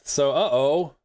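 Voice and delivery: ordinary talk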